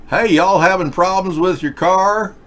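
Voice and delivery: put-on good Samaritan offering help, in a Southern accent. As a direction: do the helpful Southern stranger in a Southern accent. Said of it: "talking to me in a southern accent".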